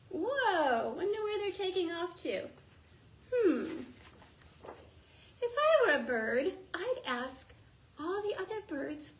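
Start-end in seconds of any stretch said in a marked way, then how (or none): none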